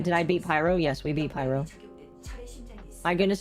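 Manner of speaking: ordinary delivery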